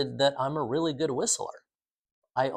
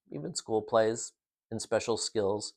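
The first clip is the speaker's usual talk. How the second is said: normal talking voice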